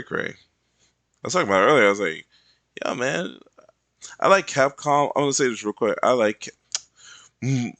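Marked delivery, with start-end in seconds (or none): 2.8-5.1 louder eager declaration; excited. Retold or imitated. Artist telling a friend he loves Capcom, loud. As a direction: announce it proudly.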